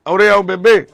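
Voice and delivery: loud, high-pitched summons; calling out. Real, not acted. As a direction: call an elder over from a distance. Calling the mother over.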